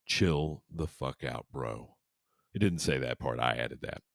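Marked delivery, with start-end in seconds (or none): 0.0-2.0 slow, lowered computer voice; lightly voiced deep computer, dropping low at the end; mock computer, slow and sinking. A narrator's reading. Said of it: "The deep computer voice, like, said".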